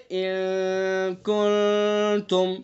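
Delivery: ان کنتم slowly held, with a hidden nasal; drawn-out ikhfa. Shown as a demonstration. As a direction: hold the nasal in کنتم long. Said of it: "جیسے".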